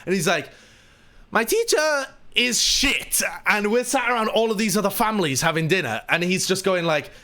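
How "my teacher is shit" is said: put-on high, slow Latvian-accented complaint; Miralyn's blunt outburst. Latvian guest asked about English lessons, blunt high accented complaint. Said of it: "he's very loud".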